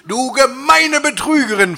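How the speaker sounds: high, loud accusation; spitting anger. A narrator's reading. Dieter confronts Laura at the door, spitting anger. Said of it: "fauchte ich Laura entgegen".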